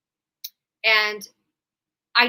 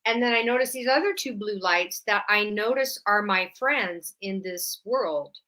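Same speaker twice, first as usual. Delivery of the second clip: usual speaking voice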